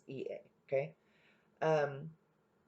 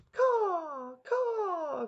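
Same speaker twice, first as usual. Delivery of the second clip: high, loud "Caw, caw" cry; crow cawing; 13 semitones higher, 7 dB louder than usual. Acted. Crow sings for the flattering fox, high and loud. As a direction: squawk it out like a crow. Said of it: "cried the crow".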